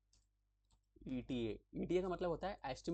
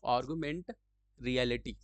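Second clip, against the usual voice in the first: louder, word by word "augmented reality"; emphatic repeat; 9 dB louder, much slower than usual. Shown as a demonstration. Teacher repeating the term to the class, louder and separate. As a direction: call out "augmented" then "reality" loudly so it sticks. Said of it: "फिर से बोलता हूँ".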